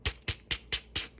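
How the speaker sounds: soft, voiceless tick sounds; quick percussive mouth clicks; imitated knocking.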